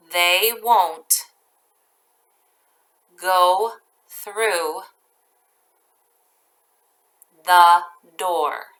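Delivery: each chunk very slow with long pauses; teacher dictating a sentence to first graders, slowly; slow dictation. Shown as a demonstration. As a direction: dictate it piece by piece. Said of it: "I'll read each part very slowly".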